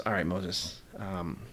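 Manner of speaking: God giving in calmly to Moses; gentle God. Retold or imitated. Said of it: "And so God says".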